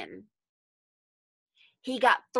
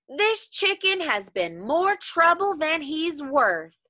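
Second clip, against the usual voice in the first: exasperated cook; 6 semitones higher than usual. Acted. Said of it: "exclaimed the cook".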